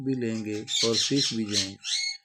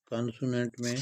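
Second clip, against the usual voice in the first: ordinary delivery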